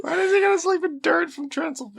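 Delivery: high, amazed question; incredulous. Real, not acted. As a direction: ask it high in disbelief.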